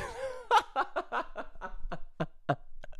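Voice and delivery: high, wheezing real laugh. Real, not acted. Cracking up at his own retold insult, real high laughter. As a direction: crack up helplessly.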